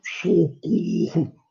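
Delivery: Socorro! dragged very slow, sound held in; muffled shout. Shown as a demonstration. Teacher showing students that a yelled Socorro! stays inside. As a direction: try to yell Socorro! but it stays inside. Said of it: "experimenta gritar socorro".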